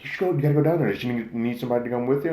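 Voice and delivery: high, loud, quick imitation of girlfriends; excited urging. Girlfriends urge her to go down, excited retelling.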